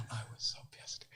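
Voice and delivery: hushed, breathless whisper of anger. Retold or imitated. Recalling his anger at Rudy, in a low whisper. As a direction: say it under your breath.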